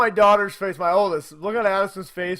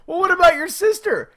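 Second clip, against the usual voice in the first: high, loud incredulous question; can't believe her nerve; dad's incredulous question; 12 semitones higher, 8 dB louder than usual. Retold or imitated. Dad questions daughter about her sister, high and incredulous. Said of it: "I'm like".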